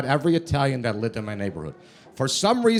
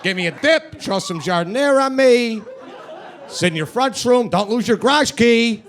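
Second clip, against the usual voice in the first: Chicago accent; 5 semitones higher than usual. Shown as a demonstration. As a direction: talk like an Italian beef could speak. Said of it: "That's my voice".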